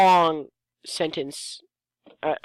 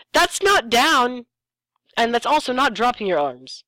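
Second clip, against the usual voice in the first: indignant protest; 6 semitones higher, 4 dB louder than usual. Real, not acted. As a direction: protest that the arm isn't down at all. Mocking the story's wording.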